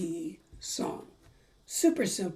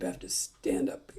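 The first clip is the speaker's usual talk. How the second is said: normal talking voice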